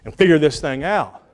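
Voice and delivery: usual speaking voice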